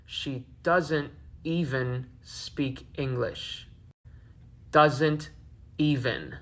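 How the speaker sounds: slow, drawn-out word by word; deliberate quoting. Retold or imitated. Vlogger quoting an accusation back to viewers, deliberate and slow. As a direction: repeat it slowly, each word set apart. Quotes back the clip's words slowly.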